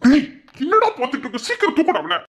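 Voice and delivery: high, agitated command; urgent scolding. Acted. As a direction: hurry a bystander into helping. Urgent orders in acted emergency.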